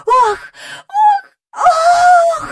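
very high, loud whoops; calling out. A narrator's reading. Jane whirls over the willow, whooping loudly. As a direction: whoop like a girl swept through the air. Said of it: "гукала Джейн".

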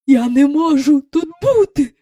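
usual speaking voice

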